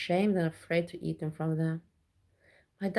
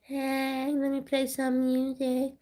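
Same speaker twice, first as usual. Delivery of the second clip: higher, slow dopey mock voice; mocking streamer; 7 semitones higher, slower than usual. Mocking streamers with nothing to say, higher dopey voice. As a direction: sneer at a lazy streamer. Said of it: "you know, like".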